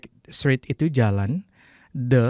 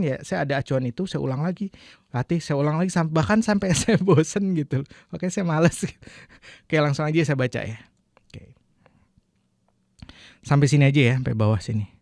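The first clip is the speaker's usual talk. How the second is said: usual speaking voice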